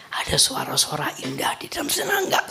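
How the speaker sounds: hushed whisper of wonder. Acted. Preacher whispering to the congregation about paradise. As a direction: draw them in with a secret hush.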